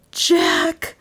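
high-pitched plea; beseeching, high cry; pleading. A narrator's reading. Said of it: "pleaded Dale".

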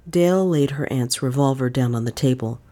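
plain reading voice

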